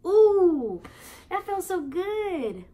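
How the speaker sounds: high, loud ooh of pleasure; delighted ooh. Real, not acted. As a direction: savor it with delight. Savoring the line read.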